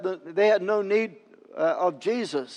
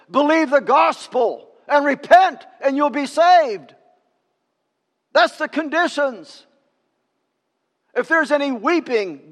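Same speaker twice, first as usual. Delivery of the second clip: raised, emphatic pulpit voice; forceful preaching; 5 semitones higher, 4 dB louder than usual. Real, not acted. Preacher urging the church to open up, forceful preaching. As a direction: drive each phrase home to the congregation.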